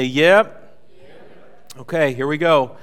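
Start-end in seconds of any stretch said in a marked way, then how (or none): 0.0-0.8 loud cheerful yep; congregation response. Shown as a demonstration. 1.0-1.8 breathy unvoiced whisper; whispered yep. Shown as a demonstration.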